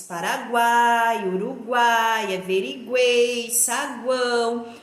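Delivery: "Paraguai", "Uruguai", "Averiguei", "saguão" slow with loud stretched endings; drawn-out list, last syllable swelling in "Uruguai"; stressed vowel clusters. Shown as a demonstration. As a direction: lean hard on the GUAI of "Paraguai" and each matching ending. Teacher lists triphthong examples.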